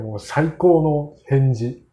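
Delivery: ordinary talk, nothing marked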